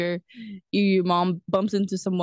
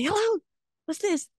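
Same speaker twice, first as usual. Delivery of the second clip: mock phone shout; 11 semitones higher than usual. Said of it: "You, you shout really loud".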